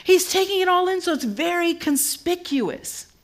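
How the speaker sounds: high, strained preaching voice; high sermon emphasis. Acted. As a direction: savor each word to the crowd. Preaching at full force.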